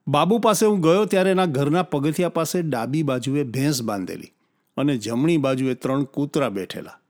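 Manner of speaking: plain reading voice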